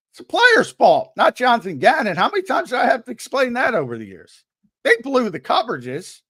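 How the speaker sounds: loud, high-pitched insistence; exasperated rant. Real, not acted. Host argues players not coach are at fault, exasperated. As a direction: argue it forcefully, fed up with repeating yourself. Exasperated argument over who is blamed.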